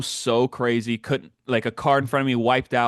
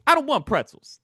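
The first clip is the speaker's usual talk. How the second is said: mock stubborn old lady; 11 semitones higher than usual. Seatmate refusing pretzels.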